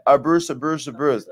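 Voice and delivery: each "abuse" said as uh-BURSE; mimicked mispronunciation. Host mimics fan saying it three times, mimicked mispronunciation. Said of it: "Say it three times".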